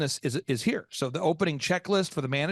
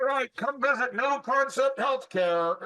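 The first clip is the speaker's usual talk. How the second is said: yelled, high and slow; loud sharp voice; 7 semitones higher, slower than usual. Retold or imitated. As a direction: hawk the name out on the street. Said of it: "Why are you yelling at me?".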